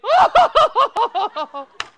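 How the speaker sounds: loud squealing laugh; imitating a daughter's shriek of laughter; high shrieking laugh. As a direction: burst into a high cackle.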